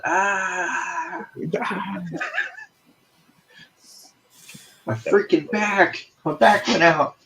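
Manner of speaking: high pained groaning complaint; having a back spasm. Pretend. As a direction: whine like your back just went out. Acting character throwing out back.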